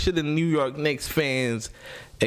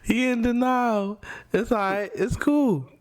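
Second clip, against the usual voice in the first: mock comforting; 10 semitones higher than usual. Mock consoling co-host.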